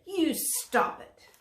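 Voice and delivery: high and slow, telling off; a scolding giraffe voice; the giraffe telling the dragon to stop. Acted. Giraffe complains of hot smoke.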